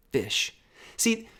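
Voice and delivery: usual speaking voice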